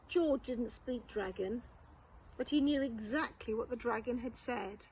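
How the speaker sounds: plain reading voice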